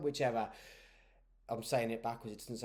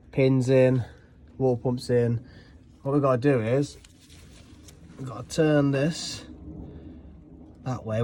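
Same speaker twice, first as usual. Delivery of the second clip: ordinary delivery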